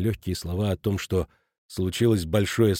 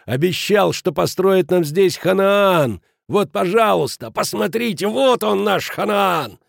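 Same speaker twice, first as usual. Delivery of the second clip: high, loud, ranting fury; roaring; 8 semitones higher, 4 dB louder than usual. A narrator's reading. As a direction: rage at the man who cheated them. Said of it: "взревел Кранер". (яростная тирада на крике)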